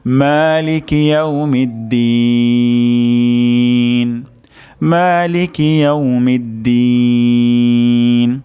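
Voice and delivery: মালিকি ইয়াউমিদ্দিন slow, দিন held long; tajweed recitation. Shown as a demonstration. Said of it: "আমি আরও দুইবার উচ্চারণ করছি". (ধীরে তাজবীদসহ মালিকি ইয়াউমিদ্দিন)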